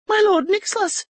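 put-on Erica, frightened young lady. A narrator's reading. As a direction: ask it like a startled noblewoman. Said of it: "she asked".

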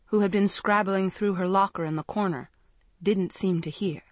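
ordinary delivery

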